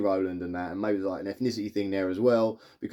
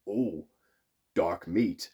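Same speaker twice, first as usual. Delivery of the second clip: low villain's aside; slow, low menacing relish, lightly acted; Freddy Krueger; 6 semitones lower, 3 dB quieter, much slower than usual. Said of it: "and then goes".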